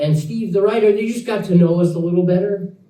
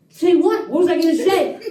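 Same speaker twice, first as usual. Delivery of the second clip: incredulous retort; 9 semitones higher than usual. Retold or imitated. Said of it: "I'm like".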